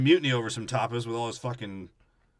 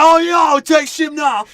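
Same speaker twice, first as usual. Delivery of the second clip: very high, very loud yell; mock battle cry; 14 dB louder, slower than usual. Scrum calling the crew to attack, mock battle cry. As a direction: holler the order at full volume.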